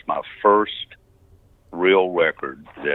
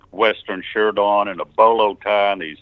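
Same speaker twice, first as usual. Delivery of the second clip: ordinary delivery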